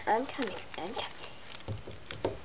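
slow soft whisper; whispered mutter. Acted. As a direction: mutter it to yourself, slowly. Answering the door muttering.

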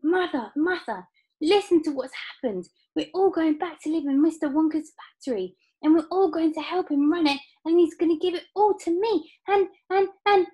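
put-on high, breathless-with-news child voice; Charlie crying out. A narrator's reading. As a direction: gush it out high, tripping over the end. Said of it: "cried Charlie".

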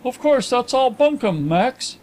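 high, dismissive gentleman's voice; Carlyle. A narrator's reading. Carlyle waving away his friend's confession, high and brisk. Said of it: "commented Carlyle".

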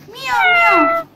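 high, loud meow meow; cat meowing. A narrator's reading. Cat refusing the hen, meowing loudly. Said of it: "So the cat said".

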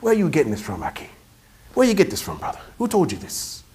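lightly imitated Boston accent, questioning; sheikh's challenge with a touch of Boston; quietly probing, mildly put-on Boston accent. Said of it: "With his Boston accent".

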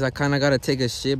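ordinary talk, nothing marked

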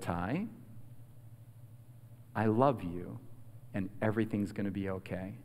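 low, very soft reassurance; tender, close. Retold or imitated. Grandma leans close to comfort grandson, tender. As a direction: speak gently, as if leaning in close. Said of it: "she leaned real close".

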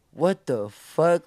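slow, loud, stretched disbelief; drawn-out shock. Retold or imitated. As the homies pop out, slow loud disbelief. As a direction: let the realization land slowly. Friends pop out, prank revealed.

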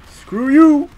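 high, loud curse; indignant outburst. Real, not acted. Player cursing at what just hit them, loud indignation. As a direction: lash out at the game.

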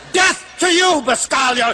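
high-pitched mock battle cry; determined drivers. Determined drivers on the L A freeway, mock battle cry. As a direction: snarl it like a hammed-up freeway fighter. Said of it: "these people with these determined expressions".

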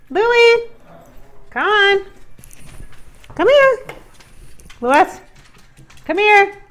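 high, loud call across the house; calling the dog. Real, not acted. To Louie the dog, calling him to come, high loud call.

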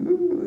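high-pitched giddy "ooh"; excited squeal. Retold or imitated. Boy with his essay awaiting royalty, excited squeal. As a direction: mimic a thrilled kid's high coo. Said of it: "he's all excited".

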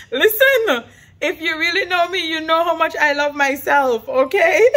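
high, loud, laughing through real tears; laughing and crying. Real, not acted. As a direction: blurt it out between laughs and tears. Mom laughing, teary about herself.

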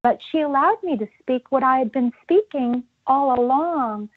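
normal talking voice

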